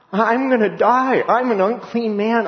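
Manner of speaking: high, panicked cry of a doomed man; terrified Isaiah. Retold or imitated. Isaiah crying out in dread before God's throne. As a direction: plead in terror before God. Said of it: "He's just going".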